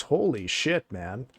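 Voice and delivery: ordinary talk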